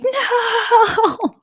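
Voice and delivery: long, high mock wail; competitive protest. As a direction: cry out in hammed-up defeat. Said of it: "My competitive nature is like".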